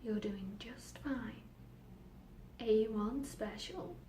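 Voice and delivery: very slow, whispery reassurance; hushed inner voice. Acted. Voices in her head soothe the girl, hushed and slow. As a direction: reassure in a drawn-out, soft murmur.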